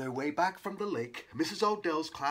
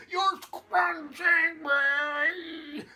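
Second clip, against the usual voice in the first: very slow, high, strained wail; drawn-out high yell of protest; 12 semitones higher, 4 dB louder, much slower than usual. A narrator's reading. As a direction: drag it out as if being twisted. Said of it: "yelled Haley".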